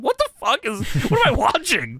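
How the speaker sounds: high, disbelieving what-the-hell; shocked at what he's seeing; incredulous rant; baffled outburst. Retold or imitated. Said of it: "I said".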